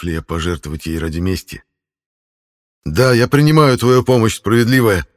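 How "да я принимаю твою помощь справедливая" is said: loud, higher oath of acceptance; resolute shout. Acted. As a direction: declare it boldly, full voice. Hero accepts the goddess's offer.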